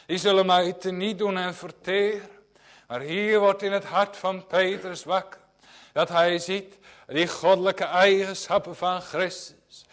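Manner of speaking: loud, fervent pulpit preaching; preaching at full force. Acted. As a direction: drive it home like a revival preacher. Preaching to the congregation. (vurig en luid preken)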